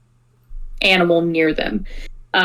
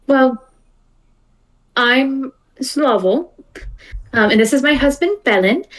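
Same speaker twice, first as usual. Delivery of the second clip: slightly higher, a lightly voiced stranger introducing her husband; character voice; 5 semitones higher than usual. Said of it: "the two Vidalcan individuals go".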